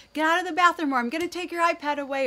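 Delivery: put-on loud, fast, high yell at a dawdling kid; yelling at son. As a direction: shout the threat through the door. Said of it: "yelling at, to my son".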